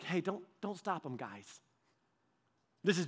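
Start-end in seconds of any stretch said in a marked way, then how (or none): 0.0-1.6 soft casual ask. Shown as a demonstration. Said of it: "This wasn't just like".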